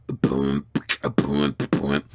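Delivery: low vocal kick-drum booms; beatbox a deep, simple bass beat. Shown as a demonstration. Streamer answering a request to do a beatbox, low beatboxing. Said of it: "Do a beatbox".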